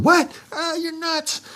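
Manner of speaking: imitating a Puerto Rican buddy brushing it off, pitched high; light Puerto Rican accent, high and scoffing. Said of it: "in that thick accent".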